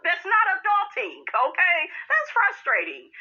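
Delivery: shrill-edged outraged complaint; exasperated high rant. Real, not acted. Ranting about a grown man's behavior.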